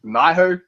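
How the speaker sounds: Nihon said as NIGH-ho; alternative pronunciation. Shown as a demonstration. Trying out a game's name, Nihon as NIGH-ho. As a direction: say Nihon with an "eye" in the first syllable. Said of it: "I call it Nihon, but".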